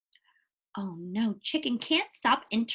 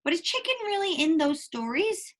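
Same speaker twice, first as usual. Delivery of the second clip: ordinary delivery, nothing marked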